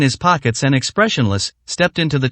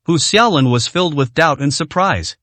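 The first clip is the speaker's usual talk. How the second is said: usual speaking voice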